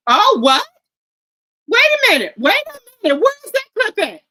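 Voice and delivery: loud, high, stunned outburst; reacting in loud disbelief to the comment; shocked disbelief. Real, not acted. Streamer reacting to a chat comment, loud shocked disbelief.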